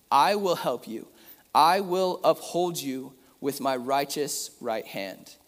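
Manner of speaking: preacher reading a verse aloud to his church; scripture reading. Acted. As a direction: proclaim the verse to the congregation.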